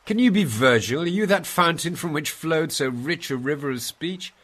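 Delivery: loud, high cry of amazement; awestruck. Acted. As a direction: burst out in overwhelmed wonder.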